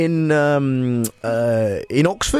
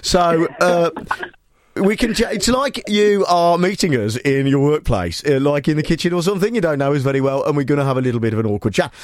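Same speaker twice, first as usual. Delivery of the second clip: mostly higher, put-on Brummie voice; Birmingham accent; 4 semitones higher than usual. Host offers caller awkward small talk, Birmingham accent. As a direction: keep chatting in a mock Birmingham accent. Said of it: "I'll do Birmingham".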